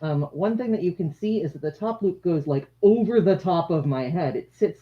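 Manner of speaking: usual speaking voice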